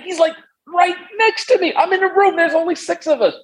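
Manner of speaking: breathless high excitement at meeting a hero; fanboying out. Real, not acted. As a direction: voice jumping way up, giddy and starstruck. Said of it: "I'm fanboying out".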